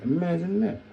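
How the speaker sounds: high, loud incredulous exclaim. Real, not acted. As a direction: sound amazed.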